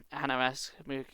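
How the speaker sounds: plain reading voice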